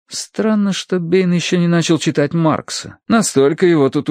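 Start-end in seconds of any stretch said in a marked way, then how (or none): none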